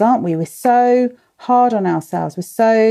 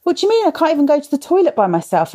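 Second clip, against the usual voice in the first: high, fast, exasperated complaining mum; imitating a frazzled mum, pitched up and rushed; whiny mum quote; 7 semitones higher, faster than usual. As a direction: play the fed-up mum who can't get a minute alone. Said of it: "they all kept saying to me".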